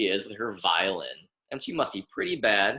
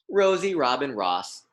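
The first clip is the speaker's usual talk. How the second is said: plain reading voice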